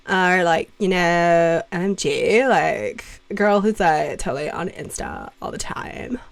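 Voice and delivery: put-on Californian Valley girl accent. Shown as a demonstration. Streamer showing off American accents to chat, Valley girl. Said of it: "That's perfect Valley girl".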